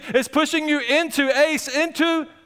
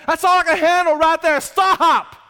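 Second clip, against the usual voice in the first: exasperated outburst; 6 semitones higher, 4 dB louder than usual. Retold or imitated. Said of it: "Man, he's there".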